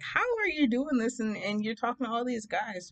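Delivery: high-pitched start, imitating nosy people; mock incredulous questioning. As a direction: imitate people demanding an explanation. People question her, retold.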